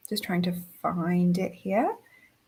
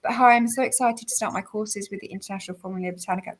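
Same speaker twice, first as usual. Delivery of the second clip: ordinary talk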